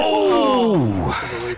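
a sudden high "Oh!"; surprised shout. Real, not acted. Co-commentator watching a shot just miss, in a surprised shout. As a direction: gasp it out as the ball flies over.

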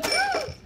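high excited gasp; sharp gasp. Real, not acted. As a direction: gasp in delight. Excited candy sharing.

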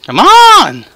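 high, loud frustrated shout; panicking gamer. Real, not acted. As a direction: yell at the broken game. Player stuck, game not working.

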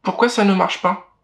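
high, irritated little brother; frustrated whine; annoyed. Acted. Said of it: "s'agace le petit frère".

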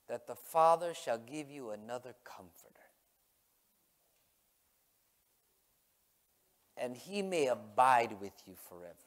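unhurried, weighty scripture recital; solemn scripture reading. Acted. Reads scripture aloud.

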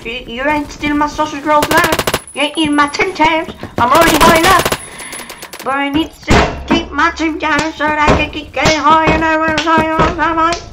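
high squeaky voice, loud and cocky; squeaky taunting gamer. Acted. Gamer taunting an opponent in a squeaky cartoon voice. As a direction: taunt the enemy in a silly high voice.

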